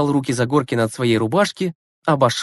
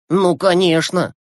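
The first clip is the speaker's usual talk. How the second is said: indignant; 4 semitones higher, slower than usual. A narrator's reading. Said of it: "негодовал Глеб".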